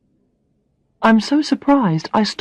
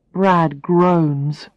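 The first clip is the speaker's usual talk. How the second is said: ordinary delivery, nothing marked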